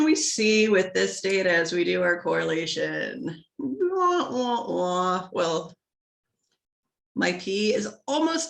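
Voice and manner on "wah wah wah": sad trombone. Acted.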